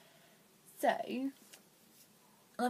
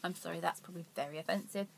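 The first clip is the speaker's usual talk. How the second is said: normal talking voice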